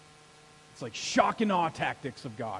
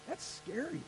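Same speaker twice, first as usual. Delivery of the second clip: ordinary talk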